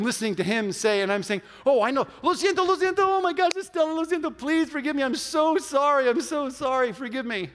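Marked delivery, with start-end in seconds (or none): 1.6-7.5 high, loud retold begging; frantic apology. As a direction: plead for forgiveness over and over, voice raised.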